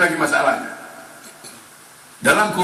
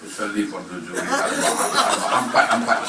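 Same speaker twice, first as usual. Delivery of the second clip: ordinary talk, nothing marked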